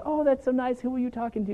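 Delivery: high, sweet mock-mom voice; imitating his mom. The roommate's mom asks who's on the phone, in a high, sweet mock-mom voice. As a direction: float up into a sugary mom voice. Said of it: "and she's like".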